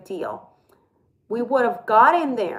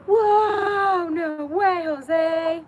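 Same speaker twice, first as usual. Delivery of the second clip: delighted surprise; 9 semitones higher, much slower than usual. Real, not acted. Gasps at finding eggs.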